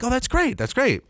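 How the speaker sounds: high, strained great; awkward cheer. Retold or imitated. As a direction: cover the awkwardness. Self voiced, awkward.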